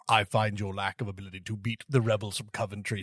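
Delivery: low, menacing villain voice; Darth Vader voice. Pretend. Vader scolding a failing officer.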